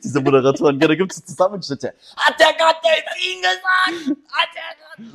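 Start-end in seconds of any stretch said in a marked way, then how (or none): 2.1-5.1 high, slow disbelieving host voice; freaking-out host. Retold or imitated. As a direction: lose it like a quiz host. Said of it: "drehen die immer so durch, diese Moderatoren". (hohe, ungläubige Moderatorenstimme)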